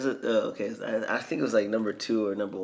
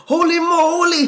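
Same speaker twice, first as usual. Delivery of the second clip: very high, loud mock exclamation; big hammed-up outburst, way up high; Austin Powers; 14 dB louder than usual. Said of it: "just says".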